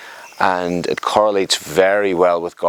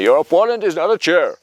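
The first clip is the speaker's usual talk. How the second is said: "Your opponent is not a chair" in a put-on Brazilian accent, pitched higher; mock Brazilian accent; 7 semitones higher than usual. Coach imitating his Brazilian teachers to the class, mock Brazilian accent. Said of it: "as the Brazilians keep saying".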